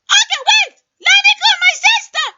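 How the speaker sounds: fast, loud, high shouting with a laugh. Acted. Caller defending his shouting, loud and fast. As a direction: yell it proudly as if normal.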